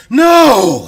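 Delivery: loud, high scream of refusal; long, piercing cry that falls away at the end. Acted. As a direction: scream it out at full force, high. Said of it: "She screams".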